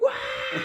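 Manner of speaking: very high, loud mock yelp; freaking-out wail. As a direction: yelp like a panicked kid. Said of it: "he's, like, freaking out".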